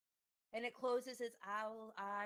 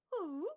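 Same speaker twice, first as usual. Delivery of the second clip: higher, louder owl call; owl hoot; 9 semitones higher, 8 dB louder than usual. Acted. GM voices the owl hooting.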